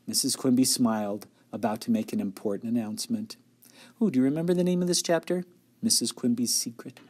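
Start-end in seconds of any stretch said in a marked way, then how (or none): none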